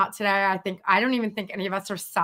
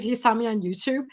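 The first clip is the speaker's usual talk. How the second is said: normal talking voice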